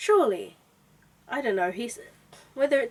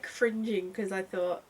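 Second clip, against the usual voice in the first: usual speaking voice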